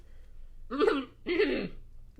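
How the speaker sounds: muffled throaty gurgle. Acted. Paralyzed guard tries to answer, gurgling. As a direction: gurgle wordlessly as if unable to speak. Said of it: "gurgled the guard".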